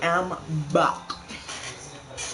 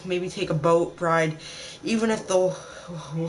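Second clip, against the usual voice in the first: ordinary talk, nothing marked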